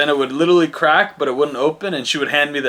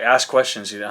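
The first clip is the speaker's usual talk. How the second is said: usual speaking voice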